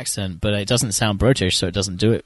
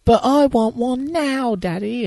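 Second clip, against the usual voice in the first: put-on high, drawn-out bratty girl in a British accent; mock a spoilt little English girl's demand, stretched out high; British accent, high and whiny on long vowels; imitated British accent; slower than usual. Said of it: "show off her British accent".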